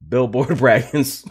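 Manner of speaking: slow, laughing at the name. Real, not acted. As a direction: savour the silly name, giggling. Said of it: "That name is hilarious".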